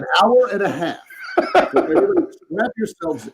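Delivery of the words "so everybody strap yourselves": high, laughing joke. Real, not acted. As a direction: laugh while teasing. Joking, laughing.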